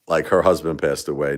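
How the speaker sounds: normal talking voice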